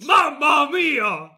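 drawn-out cartoon Italian monster; hammed-up Italian accent, slow. Shown as a demonstration. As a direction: exclaim like an Italian Frankenstein. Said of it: "Monsters and Italians have the same accent".

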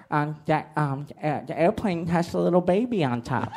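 a hint of a halting, simple big guy; lightly voiced big guy, stumbling into the line; big psych ward patient. Psych ward patient remarking on the shuttle, halting voice. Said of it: "really big guy that's just like".

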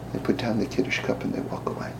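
hushed narration; whispered. Real, not acted. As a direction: whisper the ending.

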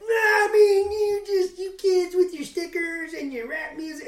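put-on high, loud, cracking old-man rant; grumpy old man voice. Old guy ranting at kids, cranking it way up high and loud. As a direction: wail like a cranky old geezer. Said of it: "is that how I sound?".

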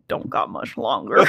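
ominous whisper. Pretend. Ominous joke about the character.

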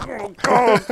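mortified groan. Retold or imitated. Mortified reaction retold.

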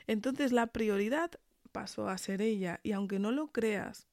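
ordinary delivery

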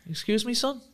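high, questioning mock manager; a hint of a stern boss. Retelling the manager's reply, mock stern challenge. Ferguson voiced challenging him.

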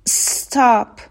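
استاپ begun on a long hiss running into "top"; drawn-out initial s. Shown as a demonstration. Teacher demonstrates joining s to next letter, stretched s. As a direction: stretch the s of استاپ, no vowel before it. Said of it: "س رو بچسبونیم به حرف بعدی".

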